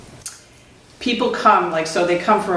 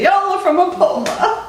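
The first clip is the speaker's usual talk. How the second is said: hearty greeting call; 11 semitones higher than usual. Retold or imitated. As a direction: sing out the name in a high, stretched greeting. Repeats his greeting call.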